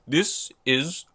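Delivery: hammy villain voice, exploding into a high, loud yell; goofy weirdo Kang. Retold or imitated. Reviewer mocks goofy Kang jumping on a table, wildly hammy. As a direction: build to an over-the-top screamed proclamation. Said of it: "he just speaks like a weirdo".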